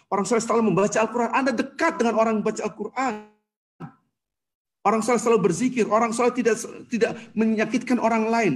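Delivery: loud, high, driving sermon delivery; preaching at full force. Acted. Preacher to congregation about pious people, loud and high. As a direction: hammer each point home to the hall.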